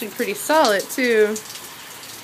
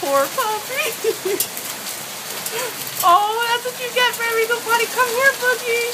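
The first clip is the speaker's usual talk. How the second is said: laughing; 12 semitones higher than usual. Real, not acted. Laughing at the dog in hail.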